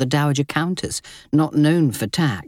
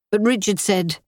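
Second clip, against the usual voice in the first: usual speaking voice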